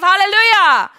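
joyful shout. Real, not acted. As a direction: lift the room with a loud cry. Preacher's exultant cry.

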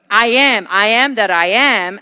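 ringing, emphatic declaration of a divine name; loud, solemn proclamation; resounding proclamation. Acted. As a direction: declare it loudly and deliberately. Proclaims God's name from scripture.

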